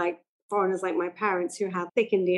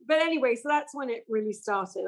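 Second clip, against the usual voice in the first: ordinary delivery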